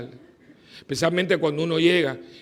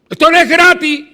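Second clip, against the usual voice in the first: even louder, higher shout of the same line; shouted repeat; 11 semitones higher, 13 dB louder than usual. Acted. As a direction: bellow it back at them, climaxing.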